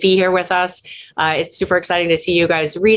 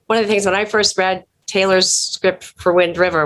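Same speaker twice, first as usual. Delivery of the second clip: normal talking voice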